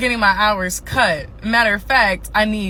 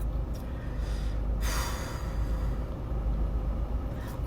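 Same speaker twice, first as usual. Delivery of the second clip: long weary sigh; 14 dB quieter than usual. Real, not acted. Despairing pause in the rant.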